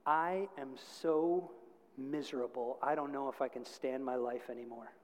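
low, soft, understated misery; miserable confession. Retold or imitated. New girl opens up to the youth group, quietly miserable.

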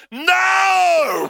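sudden loud No; inner shout. Retold or imitated. Preacher shouting his inner No at the shop lady. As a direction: shout it out from the gut. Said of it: "everything in me wanted to say".

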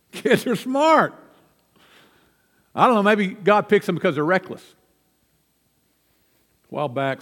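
ordinary talk, nothing marked

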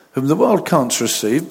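ordinary delivery

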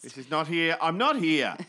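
in-denial shop lady. Retold or imitated. Op shop woman blocking out swearing, high loud cheerful denial voice. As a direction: cheerfully pretend you can't hear a thing. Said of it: "imagine her with her hands clapped over her ears".

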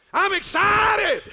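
huge, high, drawn-out yell; hammed-up excited shout. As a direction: shout it slowly as if convincing yourself. Shouts what bishop told him.

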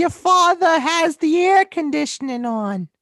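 very high, slow, loud Long Island yell; exaggerated Long Island accent. Parent yelling at Charlie, screeching Long Island accent. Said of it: "your exaggerated Long Island accent sounds part Boston".

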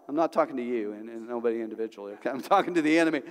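normal talking voice

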